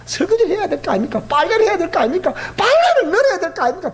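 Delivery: mock frazzled wife; exasperated wife. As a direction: list the chores in frustration. Wife pleading her chores.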